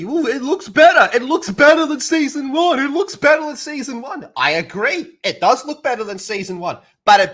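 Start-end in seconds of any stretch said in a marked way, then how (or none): 0.0-4.3 mocking fans. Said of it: "people that keep telling me".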